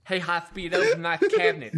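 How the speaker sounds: imitating the chief's bad lisp; mock a heavily lisping boss; really bad lisp. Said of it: "he talked with a really bad lisp".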